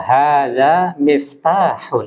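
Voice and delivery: slow "miftahun" with a distinct h; slow, articulating the h; teacher modelling the h; clear h. Shown as a demonstration. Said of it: "Huruf H diperjelas".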